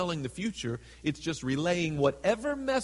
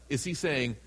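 normal talking voice

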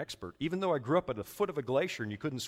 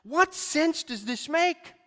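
rhetorical challenge; 14 semitones higher, 7 dB louder, much slower than usual. Acted. Rhetorical question to congregation.

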